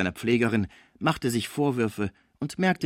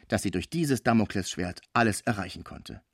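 plain reading voice

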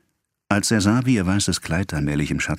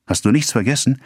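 high, eager question; lifted, happy anticipation; suddenly cheerful; 8 semitones higher, 3 dB louder than usual. A narrator's reading. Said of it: "wurde Emma mit einem Male fröhlich".

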